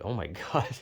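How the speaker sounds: soft, stunned half-laugh; retold shock. Dreamer recalls waking up that morning, softly laughing disbelief. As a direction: laugh quietly through the exclamation. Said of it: "I was just like".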